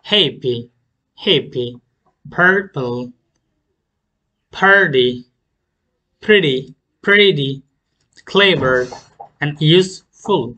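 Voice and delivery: Happy, purple, pretty strong at the start, falling after; first-syllable stress. Shown as a demonstration. Teacher models two-syllable adjectives, first-syllable stress. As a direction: punch the first syllable of clever and useful. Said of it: "first সিলেবলে stress করব".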